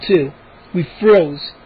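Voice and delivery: ordinary delivery, nothing marked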